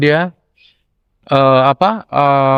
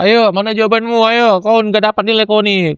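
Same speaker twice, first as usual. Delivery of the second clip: mock-stern teasing; 6 semitones higher than usual. Lecturer teasing his former truant student, playful mock-stern. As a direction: scold him in jest, like a strict lecturer playing along.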